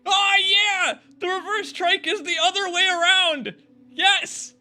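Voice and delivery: real excited yelling; thrilled shouting. Real, not acted. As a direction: cheer it like a wish come true. Thrilled by the reverse trike.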